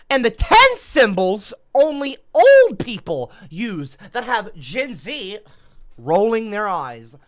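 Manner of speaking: loud, high headline announcement, slowing down; announcer title read. Acted. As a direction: proclaim the topic like a headline.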